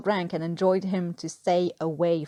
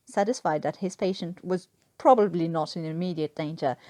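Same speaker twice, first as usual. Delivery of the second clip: ordinary delivery, nothing marked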